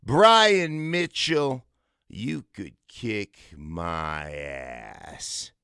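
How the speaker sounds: slow pointed callout. Retold or imitated. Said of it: "he goes".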